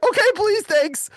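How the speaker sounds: very high retold plea; imitated nervous gratitude; anxious pleading. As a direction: grab at the offer, pitch way up. Acted anxious acceptance of help.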